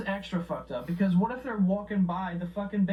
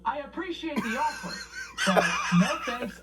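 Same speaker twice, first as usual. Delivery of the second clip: laughing refusal; 4 semitones higher, 3 dB louder than usual. Real, not acted. Laughing at a lewd sign.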